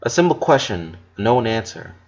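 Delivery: ordinary delivery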